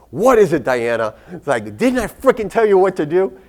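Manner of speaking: loud, high exasperated snap; retold outburst, fed up and loud; frustrated, pissed off. Doctor picks up her patient's repeat call, frustrated loud outburst. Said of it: "now I'm frustrated and pissed off".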